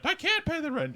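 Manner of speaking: squeaky distressed maiden; high, pleading melodrama heroine; high damsel voice. Acted.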